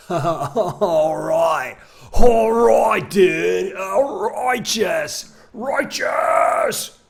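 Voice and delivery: loud high stoner-dude drawl; surfer dude. Acted. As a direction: ham up a surfer bro.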